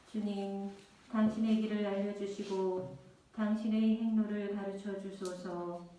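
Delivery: ordinary talk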